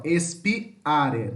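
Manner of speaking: "Espiare" split as espi, are; separated hiatus. Shown as a demonstration. Teacher repeating the example to show emphasis, separated vowels. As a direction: emphasise the break in "Espiare" between i and a. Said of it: "pronuncia separado".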